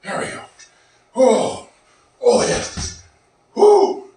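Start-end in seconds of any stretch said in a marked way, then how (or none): 1.1-4.1 relieved, excited woo; excited whoop. Real, not acted. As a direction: let it out after the set. Finishing hard set, relief.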